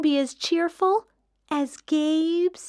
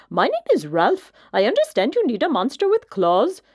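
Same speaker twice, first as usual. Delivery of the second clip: louder, smooth and silky introduction; a prim monster showing off; well-groomed monster offering its claws, silky; 3 dB louder, faster than usual. A narrator's reading. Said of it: "he said in a high, silky voice".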